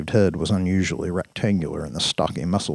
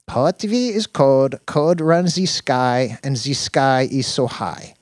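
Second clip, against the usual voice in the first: put-on slow, higher accented character voice; doting accented Dina; 8 semitones higher, 4 dB louder, slower than usual. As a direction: draw the words out dreamily in a higher accent.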